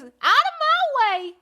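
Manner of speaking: high, loud southern goose cry. Acted. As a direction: belt it out like a brash farm goose. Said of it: "cried the goose".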